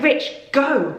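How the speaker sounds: high, retold command to go; urgent urging. New mother to partner as baby is taken, urgent urging.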